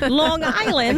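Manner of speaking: put-on drawn-out Long Island, New York accent. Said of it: "let me not be out here trying to do my New York accent".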